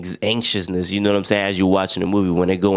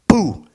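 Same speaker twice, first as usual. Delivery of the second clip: sharp high yelp of an explosion; mimicking the bunker blowing up with a shout; explosion noise; 11 semitones higher, 4 dB louder than usual. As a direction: blurt a sudden boom sound. Explosion in retold scene.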